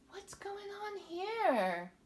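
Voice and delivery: high, slow puzzled question; mock wonder. Puzzling over the picture for children, high and slow. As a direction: wonder aloud, wide-eyed.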